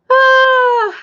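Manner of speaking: high, loud burst of happy crying; a sudden real sob of joy; tearful cry. Real, not acted. Coach hearing of client's baby, happy sob. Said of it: "happy tears".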